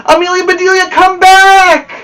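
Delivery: loud, high call after her; shouting. Acted. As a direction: shout for her to come back. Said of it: "shouted the boys".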